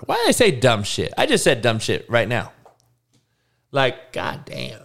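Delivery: ordinary delivery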